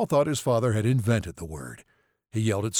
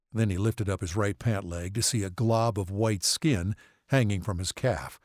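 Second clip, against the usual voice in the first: ordinary delivery, nothing marked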